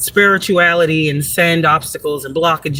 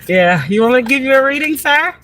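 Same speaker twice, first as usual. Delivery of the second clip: teasing offer; 8 semitones higher than usual. Real, not acted. Teasing the watching man.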